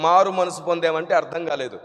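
ordinary talk, nothing marked

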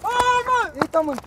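sudden loud high cry of shock; startled cry. Acted. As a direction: yelp as if caught off guard.

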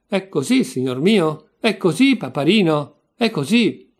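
heated taunting. Acted. Mocking questions in an acted quarrel.

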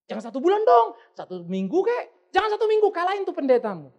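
high, loud coaxing; loud urging. Acted. Urging listener in quoted pep talk. (desakan lantang kepada jemaat)

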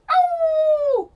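high, loud wolf howl. Shown as a demonstration. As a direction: howl at the full moon. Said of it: "Is that right?".

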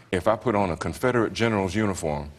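usual speaking voice

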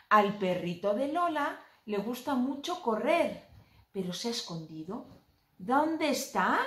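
lively picture-book reading; reading to a toddler. Acted. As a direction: read playfully to a small child.

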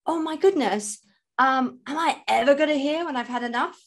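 a hint of fretting; client quoted. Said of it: "you'd say".

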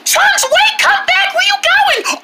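high frantic shout after the runaway dog; alarmed high shouts; panicked shouting. Acted. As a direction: yell for the dog to come back. Dog runs out the door.

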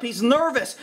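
ordinary delivery

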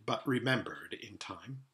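ordinary delivery, nothing marked

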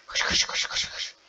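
urgent whisper to himself; whispered urging. Real, not acted. Gamer whispering at his character to run. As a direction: hiss the run command under breath.

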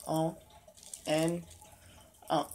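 chewing while talking; talking through a snack; mouth full of seeds. Real, not acted. Seen 3: Puts seeds in mouth just before; mouth closed, working during words.

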